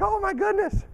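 high-pitched mock alarm; mock fright. Dad seeing devil-like moose, high mock fright. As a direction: act out a startled gasp of words, hammed-up. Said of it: "I was scared to death".

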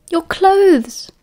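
high, squeaky blurt; sudden squeak. A narrator's reading. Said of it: "Peter squeaked suddenly".

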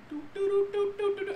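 high, soft little doo-doo ditty; high-pitched walking sound effect; carefree doo-doo humming. Acted. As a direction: toddle along with a tiny tune. Acts out creeping toward peacock.

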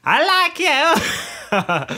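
high, very slow mock Polish accent; mock Polish accent, drawn out and high. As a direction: stretch out "I like you" in a high, hammed-up Polish accent. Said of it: "Polish is more like this".

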